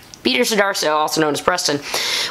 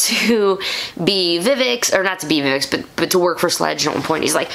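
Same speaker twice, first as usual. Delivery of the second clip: ordinary talk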